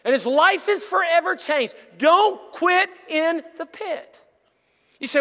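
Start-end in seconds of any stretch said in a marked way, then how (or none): 2.0-4.2 slow, drawn-out Don't quit in the pit; slow emphatic refrain. Acted. Pastor warns the church, slow and emphatic. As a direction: drive the refrain home.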